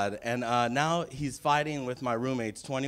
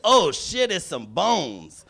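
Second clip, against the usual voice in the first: slow, drawn-out, then a higher loud "oh shit"; security lady; 4 semitones higher, 4 dB louder, much slower than usual. Retold or imitated. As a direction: play the startled airport guard.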